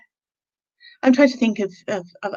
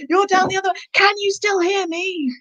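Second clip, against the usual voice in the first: calling from far away; 9 semitones higher than usual. Acted. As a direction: pretend to call across a long distance. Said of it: "suddenly I'm really far away".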